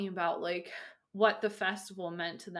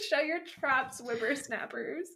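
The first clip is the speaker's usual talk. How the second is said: put-on old prospector; 5 semitones higher, slower than usual. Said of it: "when we're old and crotchety".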